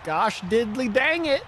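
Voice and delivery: put-on a cranky Southern homeowner yelling about vandals, in a Southern accent; grumpy Southern old man. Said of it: "you're doing a Southern accent".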